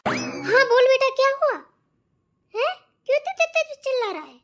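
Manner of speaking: high, lightly voiced mother asking her son why he is shouting; mother-in-law. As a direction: answer your son with concern.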